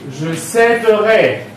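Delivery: céderai said slowly, first E open like è. Shown as a demonstration. Teacher writing out the future of céder, open E in céderai. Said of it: "tout en prononçant aussi un «et» ouvert".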